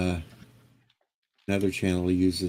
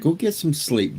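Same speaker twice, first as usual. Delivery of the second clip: higher, insistent teasing command; mock-bossy order; 8 semitones higher than usual. Real, not acted. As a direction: order him to bed, half joking. Friend teasing the tired guest.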